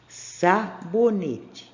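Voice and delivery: "Sabonete" very slowly, with "Sa" set apart; "Sabonete" drawn out for learners; sounded-out word. Shown as a demonstration. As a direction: stretch "Sabonete" out, lingering on the opening S syllable. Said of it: "Prestou atenção no som".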